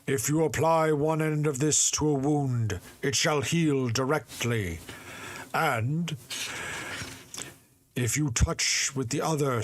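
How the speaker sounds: lightly voiced spirit giving instructions. Spirit explains the rag.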